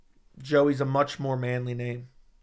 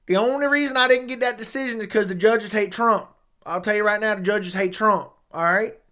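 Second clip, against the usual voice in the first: country accent; 5 semitones higher than usual. Shown as a demonstration. Streamer as country fighter blaming the judges, country accent. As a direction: protest the decision in a country accent. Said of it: "What's your best country accent?".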